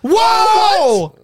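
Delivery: high, loud exclamation; shocked shout. Real, not acted. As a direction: burst out in surprise at the reveal. Shocked by the ranking reveal.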